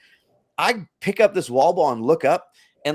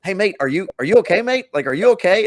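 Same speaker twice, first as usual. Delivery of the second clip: concerned Australian "mate", pitched higher and louder; mock Aussie asking if you're okay; Australian accent, worried; 6 semitones higher, 6 dB louder than usual. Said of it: "I'm not gonna butcher their accent".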